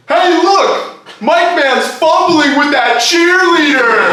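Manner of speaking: high, loud drawn-out holler; heckler's shout. Retold or imitated. Front-row fan mocking the mic man, high heckling shout. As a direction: imitate a fan yelling across the stadium.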